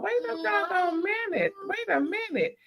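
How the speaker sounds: high, soft, sassy disbelief; incredulous sass. Retold or imitated. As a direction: pitch way up like a shocked auntie. Reacting to the therapy scene.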